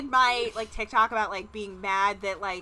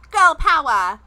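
loud, higher hammed-up rallying cry; mock girl-power slogan; 6 semitones higher, 12 dB louder, much slower than usual. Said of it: "This one is gonna be more like".

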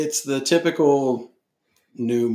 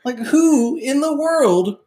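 incredulous disgust; 11 semitones higher than usual. Real, not acted. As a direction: sound like you can't believe anyone would. Mocking disbelief about Palpatine.

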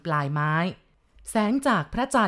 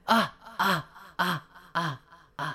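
ghostly cackle. Acted. Ghost head laughing at the chief, mocking cackle. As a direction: chop the laugh out cruelly. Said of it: "พร้อมกับเสียงหัวเราะเยาะ".

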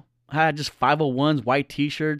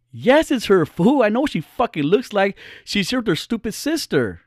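higher, emphatic friend voice; friend insisting; 7 semitones higher than usual. Retold or imitated. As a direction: insist she's sure, annoyed.

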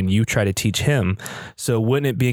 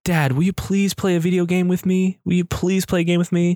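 put-on high child's plea; little kid voice; 8 semitones higher than usual. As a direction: beg sweetly like a small son. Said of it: "hear those words echoing in my head".

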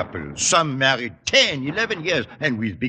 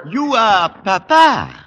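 loud, slow, delighted "Papa"; delighted exclamation; 7 semitones higher, 8 dB louder, slower than usual. Acted. Frenchman greeting the supposed father, delighted and loud.

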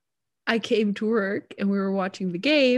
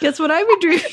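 higher, faster, laughing; embarrassed laugh; 9 semitones higher, 6 dB louder, faster than usual. Real, not acted. Confessing an embarrassing dream to her friend, giggling. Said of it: "this is super embarrassing".

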